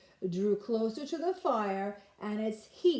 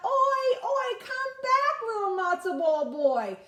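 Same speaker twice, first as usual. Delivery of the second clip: high, loud call across the river; 13 semitones higher, 6 dB louder than usual. Acted. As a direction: shout after him from the far bank. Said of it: "calling out".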